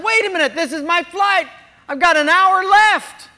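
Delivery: loud, high, laughing protest; insistent protest. Retold or imitated. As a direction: argue his flight isn't gone. Insisting flight is his.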